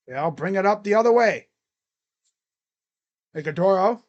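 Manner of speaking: excited sportscaster calling a basketball game; live play-by-play. Acted. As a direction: call the game like a courtside announcer.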